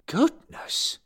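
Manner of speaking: shocked breathy gasp. A narrator's reading. Bill shocked by the swearing, gasped. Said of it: "Bill gasped".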